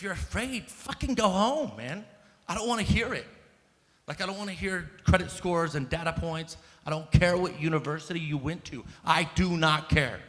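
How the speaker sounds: fired-up, daring the audience; impassioned. Real, not acted. Telling cowardly officials to go home, loud and fierce.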